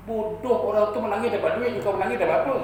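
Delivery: high-pitched exasperated ribbing; mock scolding. Nephew teasing his aunt for crying along, mock scolding.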